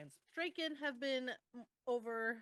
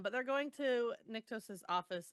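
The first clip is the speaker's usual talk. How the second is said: normal talking voice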